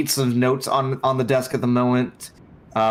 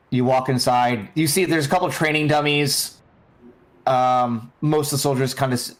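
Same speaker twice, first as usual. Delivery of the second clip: ordinary talk, nothing marked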